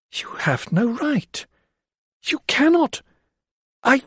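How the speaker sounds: high, breaking, halting words; words catching in anguish; anguished, tongue-tied. A narrator's reading. Lydia facing the men, anguished and tongue-tied. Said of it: "Her anguish was tying her tongue".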